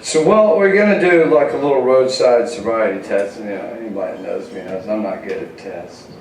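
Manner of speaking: ordinary delivery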